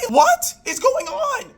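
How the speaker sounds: very high, drawn-out disbelief; exasperated outburst. Retold or imitated. Wife confronting husband about the hole, exasperated squeal. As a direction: pitch leaps up, slowly stretched.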